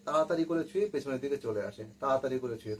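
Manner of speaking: ordinary delivery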